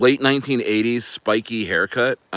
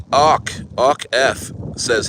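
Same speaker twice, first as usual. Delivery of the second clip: usual speaking voice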